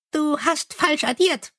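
high, chirpy little imp. Acted. Imp soothes the angry Mumm, high and chirpy. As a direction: pipe it up high like a tiny helpful imp. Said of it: "sagte der Kobold".

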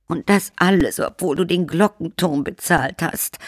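high, reproachful vampire countess. Acted. Countess reproaches the count about the bell tower. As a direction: needle her husband as the countess. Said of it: "fügte die Gräfin hinzu".

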